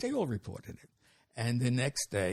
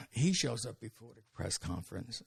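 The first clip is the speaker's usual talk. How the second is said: usual speaking voice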